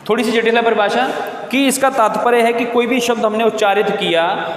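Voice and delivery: usual speaking voice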